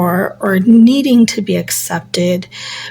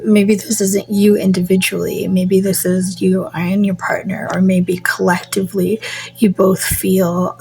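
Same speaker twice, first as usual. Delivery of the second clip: ordinary talk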